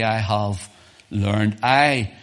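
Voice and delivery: emphatic, slow quote with "I" punched. Retold or imitated. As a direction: stress on I, slow and loud. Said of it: "Paul says emphatically".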